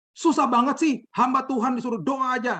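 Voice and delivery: imitating an exasperated, high-pitched rant; high, heated mock tirade; angry outburst. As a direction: complain loudly and high, mimicking someone fed up. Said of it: "udah mulai marah-marah".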